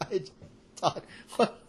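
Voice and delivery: high, loud laughing talk. Real, not acted. Laughing at the funny line.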